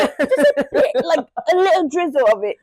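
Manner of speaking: real laughter breaking up the words; laughing. Real, not acted. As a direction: giggle it out. Joking about wanting slight racism.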